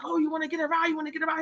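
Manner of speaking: offering a ride. Retold or imitated. Sailboat man offers.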